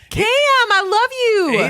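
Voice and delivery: high, loud, drawn-out call; mock fangirl shout. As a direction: holler it like an adoring fan. Mock fan calling out to golfer.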